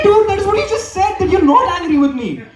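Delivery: high-pitched laughing exasperation; exasperated high laughing protest. Retold or imitated. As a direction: squeak it out in disbelief, laughing. Exasperated reply in the story.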